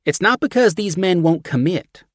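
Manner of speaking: high, loud brassy Southern woman; thick Southern accent. A narrator's reading. Steph shoving chili at John, thick Southern accent. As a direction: push the chili on him, Southern.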